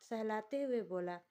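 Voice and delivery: ordinary talk, nothing marked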